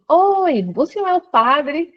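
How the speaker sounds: alto e agudo, chamando animada; chamando o padre. Retold or imitated. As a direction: chame o padre com ansiedade. (chamando o padre, animada e aguda)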